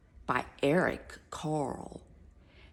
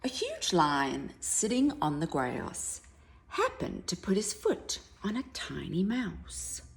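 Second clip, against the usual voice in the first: ordinary delivery, nothing marked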